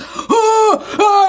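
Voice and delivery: high mock gasp and stammer; startled gasp. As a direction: imitate a man caught out, gasping. Scared man flees the husband.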